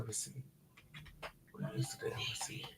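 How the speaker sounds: breathy under-the-breath whisper. Real, not acted. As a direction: hiss it to yourself while searching. Searching for receipt, muttering aside.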